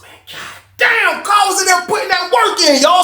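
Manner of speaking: high, straining disbelief; astonished exclamation. Retold or imitated. Retold shocked reaction to the sight.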